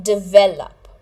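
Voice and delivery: "Develop" as di-VEL-up for repeating; "Develop" stressed on its second syllable; model pronunciation. Shown as a demonstration. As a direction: say "Develop" with a strong middle VEL. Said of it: "Repeat it after me".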